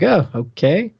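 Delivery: ordinary talk, nothing marked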